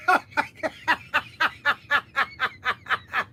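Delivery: long, breaking fit of laughter; helpless overjoyed laughing; hysterical laugh. Real, not acted. Vlogger overjoyed at the box set, laughing hysterically.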